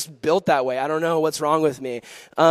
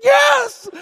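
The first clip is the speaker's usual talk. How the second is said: very high, loud bawled reply; mock tearful wail; 9 dB louder than usual. Crying girl admitting her bad day, mock tearful wail. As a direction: imitate a weepy girl wailing it out. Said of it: "streaming with makeup and tears".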